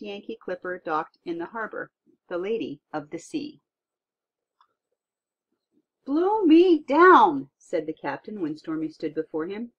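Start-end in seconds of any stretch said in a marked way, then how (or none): 6.0-7.5 slow, drawn-out, much higher and louder astonished cry; amazed exclamation. Acted. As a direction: gasp it out as a stunned old captain. Captain amazed at giant boy.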